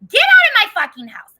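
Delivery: high, loud angry yell; acted-out furious outburst; snapped. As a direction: snap and yell it at the relatives. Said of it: "I just, like, snapped".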